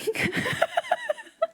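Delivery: a high, squeaky burst of laughter; high squealing laugh. Real, not acted. Laughing at Goomba in suit.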